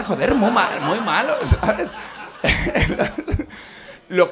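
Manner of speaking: loud and slow, barely getting the words out through laughing; laughing loudly. Real, not acted. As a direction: stretch it out slowly, breaking into laughter. Cracking up over the socks.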